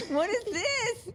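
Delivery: high, drawn-out squeal of disbelief; slow, high-pitched question through laughter; real incredulous outburst; amused disbelief. Real, not acted. Laughing reaction to odd pose.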